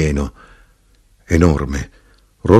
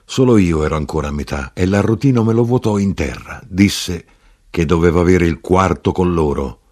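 ordinary delivery, nothing marked

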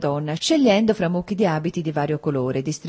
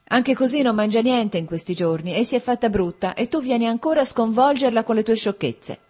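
higher-pitched, mildly angry telling-off; half-serious maternal scolding; cross scolding; 5 semitones higher than usual. A narrator's reading. Princess scolding her future son-in-law, mildly cross. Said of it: "si arrabbiò e lo mandò a casa".

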